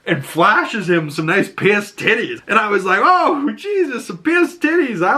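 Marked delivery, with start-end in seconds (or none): 3.0-5.1 high-pitched, delighted surprise; mock amazement. Viewer reacting to a flashing fan, high mock amazement. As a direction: gasp it out as playful shock.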